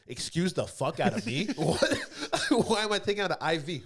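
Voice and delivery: loud, high incredulous retort with laughs breaking in; replayed outrage, laughing through it; laughing indignation. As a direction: act out being appalled while cracking up. Retells own indignant reaction, laughing.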